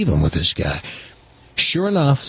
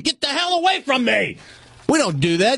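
high, hammed-up indignant cabbie; mock outraged shout; 11 semitones higher than usual. Cab driver shooing off the odd passenger, high mock outrage. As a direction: throw him out of the cab, all mock disgust.

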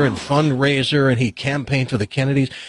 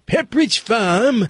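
put-on drawn-out, higher old New England accent; Pepperidge Farm guy's accent; 7 semitones higher, slower than usual. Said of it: "that's the Boston thing".

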